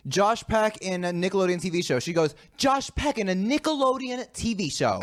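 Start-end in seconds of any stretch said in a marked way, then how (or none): 2.6-5.0 high-pitched mimicked repetition; question repeated as answer. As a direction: echo the clue back confidently, pitched high. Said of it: "repeats the question, but, like, as an answer".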